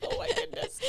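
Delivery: laughing through the words. Real, not acted. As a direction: giggle while describing it. Laughing at her own image.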